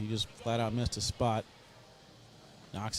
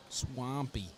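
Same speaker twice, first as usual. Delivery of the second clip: put-on New Orleans accent; 6 dB quieter than usual. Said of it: "saying these with an accent".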